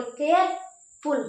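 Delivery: Careful drawn out with a gap before फुल; pause after care. Shown as a demonstration. Teacher demonstrating where to stop, careful slowly split after care. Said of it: "care पे रुके".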